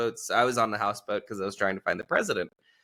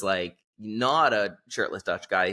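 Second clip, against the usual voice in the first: usual speaking voice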